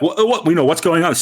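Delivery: mock alarmed pedestrians; lightly voiced passersby; confused bystanders. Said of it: "are starting to like".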